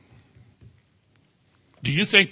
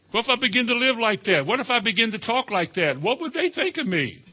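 worried; 7 semitones higher than usual. Retold or imitated. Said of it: "voicing worried believer".

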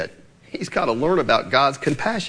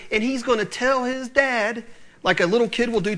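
ordinary talk

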